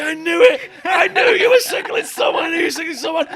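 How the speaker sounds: retold yell, high and loud; paranoid shout. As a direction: yell it like a man who's lost it. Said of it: "He's like".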